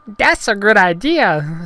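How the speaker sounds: high, loud, slow alien voice; Jar Jar Binks. Acted. Gungan agrees to taste test, high slow goofy voice. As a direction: agree in drawn-out cartoon falsetto. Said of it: "said Jar Jar".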